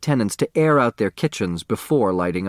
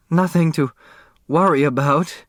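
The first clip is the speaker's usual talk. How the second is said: hoarse; 6 semitones higher, 3 dB louder than usual. Acted. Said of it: "she repeated hoarsely".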